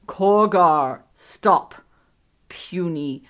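loud, blunt brute cutting in; a dim oaf interrupting word by word; Korgar. A narrator's reading. Korgar cuts in on Elutriel.

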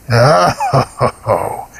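wheezy monster chuckle, first ho high; wheezing laugh. A narrator's reading. Golgoroth mocking the boy with a wheezing monster laugh. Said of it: "wheezed Golgoroth".

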